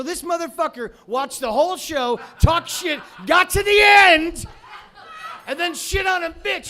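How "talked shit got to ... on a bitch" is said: rising rant. Real, not acted. Building rant about troll.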